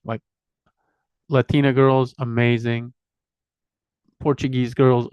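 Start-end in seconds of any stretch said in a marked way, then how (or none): none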